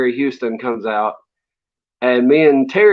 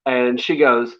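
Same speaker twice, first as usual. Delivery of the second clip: ordinary talk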